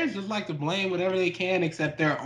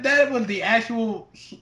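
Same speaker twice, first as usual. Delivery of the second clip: ordinary delivery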